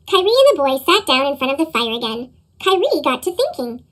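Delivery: usual speaking voice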